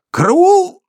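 high panicked squeak; squeaked cry for help. A narrator's reading. Sharikov squeaks for help as he's grabbed by the throat, panicked. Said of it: "пискнул Шариков".

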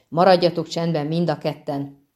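ordinary delivery

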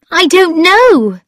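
high, loud, drawn-out wail; distressed cry. A narrator's reading. Girl unsure if she is in love, crying out. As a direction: cry it out slowly, at a high pitch. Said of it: "she cried".

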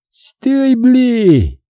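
high, drawn-out pursuer's yell; high-pitched order called from behind; yelled command. A narrator's reading. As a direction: holler a halt from a distance. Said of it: "Тотчас же заорали сзади".

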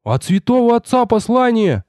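high-pitched entranced exclamation; dazed, excited boy's voice; spellbound repeating. A narrator's reading. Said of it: "как заговорённый твердил послушник".

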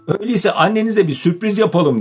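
high-pitched, delighted suggestion; happy, smiling. A narrator's reading. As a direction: propose a surprise through a smile. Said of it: "mutlulukla gülümseyerek". (mutlulukla gülümseyerek)

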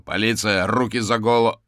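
loud, commanding shout; bellowed warning to a suspect; police shout. A narrator's reading. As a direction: shout it as a police order on bursting in. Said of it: "распахнул её и закричал".

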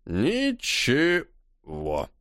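put-on slow slurred drunk voice. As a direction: drag it out, tipsy. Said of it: "пьяно помотал головой Маринер".

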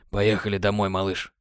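gently, almost murmured; tender Sam. Acted. (нежно, тихо)

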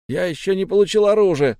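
loud, high-pitched protest; indignant complaint to the boss. A narrator's reading. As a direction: object in outrage. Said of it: "возмутился последний".

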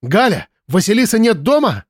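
high, urgent cry; cried into phone. A narrator's reading. Woman asks mother about missing girl, urgent cry. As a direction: call it out in panic down the line. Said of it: "закричала в трубку".

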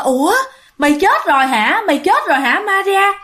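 aunt asking in shock if she is dead; a spooked aunt asking Maria if she's dead; startled aunt. Retold or imitated. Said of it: "người dì đó quay lại hỏi".

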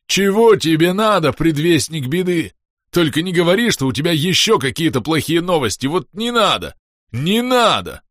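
высокий громкий завывающий голос; выть сверху, как нечисть; нечеловеческий вой. Acted. Клест продолжает выть. (нечеловеческий вой)

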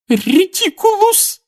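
very high, slow, sobbing cry of the spell; sobbed spell. Acted. Mrs Weasley fighting the boggart, sobbing the spell. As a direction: wail the spell through tears. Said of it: "прорыдала миссис Уизли".

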